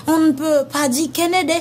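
usual speaking voice

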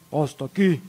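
lightly voiced burly guard; imitated security heavy; bouncer. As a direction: block the way like a bouncer, voice a little low. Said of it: "me detuvieron dos gorilas, que dijeron".

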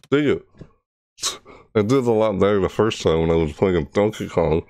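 ordinary talk, nothing marked

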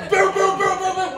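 imitated banging on the stall door; pounding door knocks. Knocking again.

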